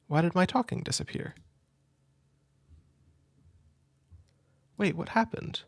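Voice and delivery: startled, high and confused; suddenly thrown, voice jumping up; surprised. Real, not acted. Captions suddenly vanish on stream.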